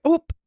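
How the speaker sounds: "Ope" as a quick "oh" closed on a p; Minnesotan ope. Shown as a demonstration. Shopper after a near miss with a cart, blurted "Ope". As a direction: blurt "Ope" as one short syllable. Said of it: "If you use the word ope".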